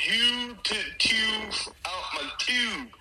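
slow, high mock Baltimore accent. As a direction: drag out those Baltimore vowels, exaggerated. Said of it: "the Baltimore accent is definitely the most disgusting".

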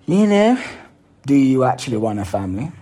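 sudden high, loud you know; emphatic call. Real, not acted. Coach jolting his listener with a loud call. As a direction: jolt the listener awake.

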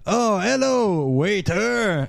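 high, drawn-out hammed-up French accent; mock French greeting; fake French accent. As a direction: put on a silly French lilt, stretched and high. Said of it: "when [name] tries to be French".

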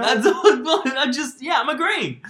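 high, laughing fluster; laughing denial. Real, not acted. Co-host, teased about benefits, laughs while denying. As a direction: laugh it off, caught out.

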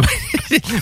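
quiet high giggle trailing off; soft high laugh. Real, not acted. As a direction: let a soft laugh slip out. Laughing at his own accent. (petit rire aigu et doux)

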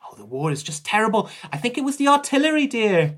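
high nurse voice climbing as she explains; evasive nurse. Acted. Nurse dodging a question about missing teeth, high and evasive. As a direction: brush it off in a high, dotty nurse voice.

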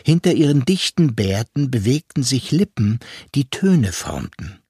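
ordinary delivery

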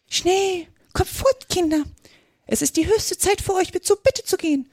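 very high, anxious little bird; trembling canary peep. A narrator's reading. Canary hurries its little ones away, piping nervously. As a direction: cheep urgently in a tiny high voice. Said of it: "piepste zitternd zu seinen Kleinen".

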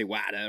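"Wada" for water, final R dropped; Boston pronunciation. Shown as a demonstration. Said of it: "you guys say".

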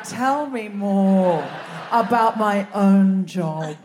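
slow, drawn-out and low; mock enthralled. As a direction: stretch each word as if mock-seduced. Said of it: "you'd be like".

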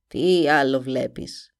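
put-on low, grim old witch. A narrator's reading. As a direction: croak it like a sinister witch. (φωνή μάγισσας)

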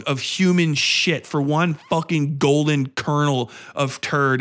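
ordinary delivery

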